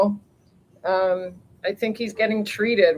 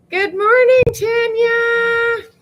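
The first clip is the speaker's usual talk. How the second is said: very high, loud singsong; put-on greeting voice; 6 dB louder, much slower than usual. Vlogger greeting a viewer by name, silly high singsong. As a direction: sing it out high, holding the last note. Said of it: "Why did I use that voice?".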